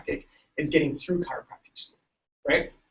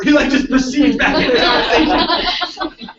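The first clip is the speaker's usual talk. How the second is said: laughing; 9 semitones higher, 7 dB louder than usual. Real, not acted. Speaker cracks up retelling the Texas cop story, laughing.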